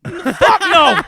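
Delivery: high, loud incredulous shout; outraged denial. Retold or imitated. Son answering mom's question, loud outraged mock yell. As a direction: shoot it down at full volume, hammed up. Acted outraged reply to mom.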